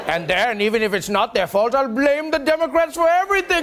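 high, gloating bad-guy voice; movie villain voice. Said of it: "like a cliched movie bad guy".

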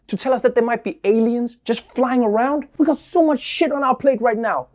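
ordinary talk, nothing marked

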